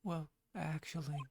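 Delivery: high, stammering then whispered; high flustered husband. Acted. Husband flustered greeting his wife, high stammering voice. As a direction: stumble over a French hello. Ron speaking to Desiree.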